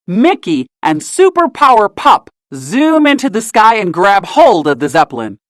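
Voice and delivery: ordinary delivery, nothing marked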